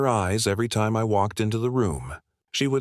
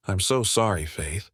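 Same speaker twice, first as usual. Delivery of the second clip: usual speaking voice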